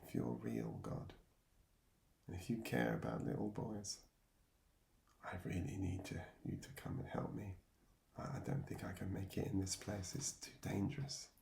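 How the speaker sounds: hushed, breathy plea to God, nearly soundless; tearful whispered prayer. Retold or imitated. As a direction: whisper it like a frightened boy praying in bed at night. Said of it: "through my tears I said".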